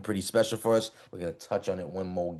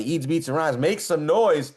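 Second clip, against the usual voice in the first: hype shout; 6 semitones higher, 4 dB louder, slower than usual. Acted. Hyping up the show intro.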